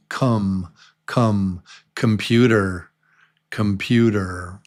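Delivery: "computer" opening with a reduced "come"; schwa first syllable. Shown as a demonstration. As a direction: say "computer" as kum-PYOO-der, not kahm. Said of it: "but it's really".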